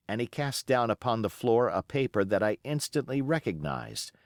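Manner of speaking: plain reading voice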